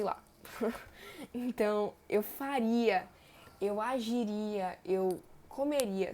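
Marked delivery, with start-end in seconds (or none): 2.1-6.1 faria, agiria, comeria drawn out slowly, leaning on ria; slow teaching voice stressing ria; slow ending demo. Shown as a demonstration. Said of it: "todos esses verbos terminam em ria".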